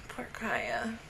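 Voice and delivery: soft pitying whisper; sympathetic whisper. Real, not acted. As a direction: whisper it with pity. Pitying character on screen.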